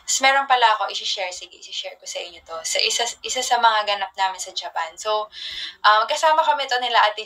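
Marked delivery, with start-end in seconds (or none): 1.3-2.5 whispered aside to the viewers; soft unvoiced confiding whisper. Real, not acted. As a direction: lean in and whisper the secret.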